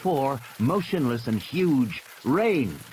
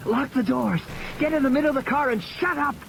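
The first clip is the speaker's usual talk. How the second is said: put-on higher, sharp boy's orders; bossy older brother; 13 semitones higher than usual. Tim orders his sister to lock the doors and hide. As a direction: order her about urgently. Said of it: "He turned to Lex".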